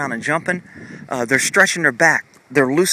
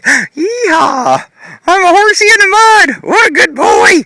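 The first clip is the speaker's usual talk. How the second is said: much higher and louder, whooping like a happy horse; playful horse voice; 12 dB louder, slower than usual. Pretend. Voicing the horse rolling in the mud, loud high whoop. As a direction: squeal it out as the giddy horse. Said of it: "And he's like".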